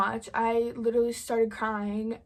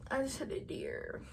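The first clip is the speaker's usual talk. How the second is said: repeated softer, fading to a whisper; trailing whisper; 2 semitones higher, 7 dB quieter than usual. Retold or imitated. Repeating the news to Jim, fading into a dazed whisper. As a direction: let it drop to a stunned whisper.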